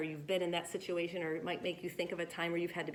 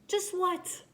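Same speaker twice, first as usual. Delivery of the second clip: pitched way up and louder, demanding; indignant question; 10 semitones higher, 4 dB louder than usual. Acted. Louis demands the reader finish his sentence. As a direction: snap the question up high, impatient. Character demands the missing word.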